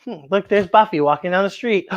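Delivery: ordinary delivery, nothing marked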